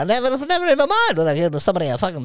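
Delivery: high squeaky voice through laughter; squeaky Elliot impression. Mocking Elliot's shocked reply, high squeaky voice. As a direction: squeal it like an offended kid.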